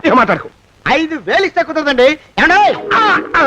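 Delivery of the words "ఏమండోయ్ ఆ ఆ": loud, higher rising holler; yelled call. Acted. Blackmailer rejecting the five thousand offer, yelled call. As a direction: call out as a threat. Threatens by calling out during haggling.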